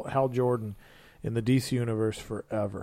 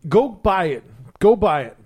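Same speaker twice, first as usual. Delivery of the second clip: loud, higher-pitched push; emphatic urging; 6 semitones higher, 9 dB louder than usual. Real, not acted. Podcaster urging listeners to buy a comic, emphatic. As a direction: urge it loudly, line by line.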